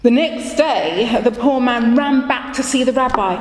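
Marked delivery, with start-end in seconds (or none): none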